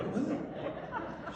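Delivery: high, incredulous one-word question; taken aback. Retold or imitated. Son to mom after her odd request, taken aback.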